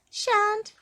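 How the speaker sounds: very high, loud cheeky refusal; grinning goblin. A narrator's reading. Goblin refusing Mister Uppity's demand, grinning high voice. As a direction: refuse with a high-pitched grin. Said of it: "grinned the goblin".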